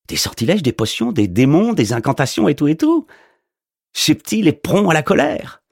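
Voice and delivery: a goofy postman who can't believe it; incredulous mailman. A narrator's reading. Postman asking if the wizard does party shows, incredulous.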